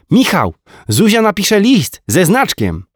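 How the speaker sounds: put-on high, slow little boy calling out; small boy telling his dad the big news; child voice. A narrator's reading. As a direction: announce it proudly like a small kid.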